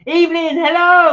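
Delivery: loud, high sing-out hello; excited greeting. Real, not acted. Host hailing a viewer joining the live chat, excited greeting. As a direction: call out brightly to a late arrival.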